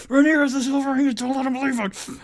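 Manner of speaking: high, loud, fast mock alarm; mock panic note to self; reading own note. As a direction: blurt the reminder, way up. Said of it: "many notes to myself about".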